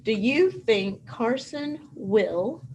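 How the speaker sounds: each word drawn out slowly as if writing it; slow dictation. Shown as a demonstration. Teacher dictating the sentence to her class, slow. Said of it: "I'm gonna write it down here".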